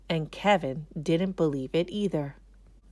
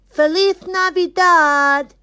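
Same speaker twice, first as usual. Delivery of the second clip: high, loud, slow Spanish greeting; festive greeting; 12 semitones higher, 12 dB louder, slower than usual. A narrator's reading. Moises greets the class in Spanish, joyful. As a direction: sing it out like a holiday cheer. Holiday greeting, second take.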